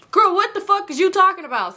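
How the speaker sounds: high-pitched, incredulous comeback; indignant disbelief. Retold or imitated. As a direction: act out affronted disbelief, pitch way up. Indignant retort to friend's text.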